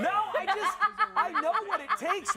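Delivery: high, loud, amused self-defence; laughing protest. Real, not acted. Co-host denies jinxing the player, laughing protest. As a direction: protest loudly, high-pitched, through laughter.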